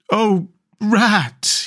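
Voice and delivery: high cry of relief after a fright; exhausted little animal crying out to a friend; high-pitched, trembling-hearted character voice; frightened cry. A narrator's reading. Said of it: "he cried".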